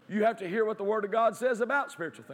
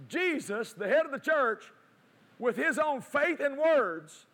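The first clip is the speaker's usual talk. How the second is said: higher, slow, drawn-out proclamation; impassioned preaching; 5 semitones higher, slower than usual. Real, not acted. Preacher declaring the mountain removed to congregation, impassioned. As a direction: proclaim it with rising force, stretching the phrases. Preacher builds to full force.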